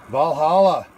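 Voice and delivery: name called out loud with a falling finish; excited announcement. Real, not acted. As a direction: proudly present the dish by name. Announcing the sausage's name again.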